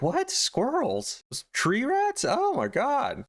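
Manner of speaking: mock-incredulous, high-pitched retort; incredulous outburst. Husband hears of wife's squirrels from neighbor, incredulous. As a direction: act out a husband aghast at squirrels.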